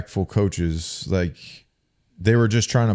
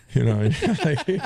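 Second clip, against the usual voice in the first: laughing; 6 semitones higher, faster than usual. Real, not acted. Laughing at the retold story.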